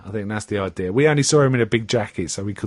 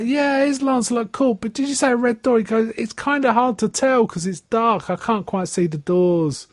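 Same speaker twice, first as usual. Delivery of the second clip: higher, puzzled driver's voice; limo driver; 10 semitones higher than usual. Retold or imitated. Limo driver hunting for the red door, raised and puzzled. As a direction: squint at the dark street, raised voice.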